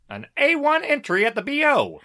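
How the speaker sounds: put-on high, loud old Hollywood headline voice; old-timey showbiz guy. Reading out Variety's Casablanca headline, hammed-up old-timey voice. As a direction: proclaim it like a vintage trade-paper announcer. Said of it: "like, some old-timey guys".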